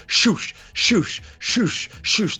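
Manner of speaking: high, repeated shoosh sound effect; mimicking the rush of the zipline trains; whooshing cable noise. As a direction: imitate trains sliding past on the cable. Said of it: "imitating the cable sound".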